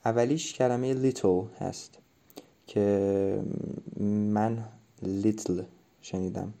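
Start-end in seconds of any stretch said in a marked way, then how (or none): none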